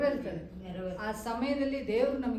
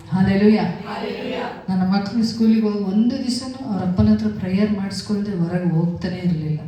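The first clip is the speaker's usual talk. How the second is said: loud, fervent preaching to the congregation; full-force preaching; 18 dB louder than usual. Acted. Pastor urging the women to pray for their families, loud and fervent. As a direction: preach it out, pushing every line.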